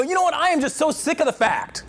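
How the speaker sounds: hammed-up whiny grievance; mock complaining husband. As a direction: fake a fed-up husband complaining. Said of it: "But if you go".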